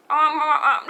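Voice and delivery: wordless "um, um" noises mimicking painful talk; mock trying to talk without moving a sore mouth; imitated hurt-mouth mumble. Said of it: "I was talking like".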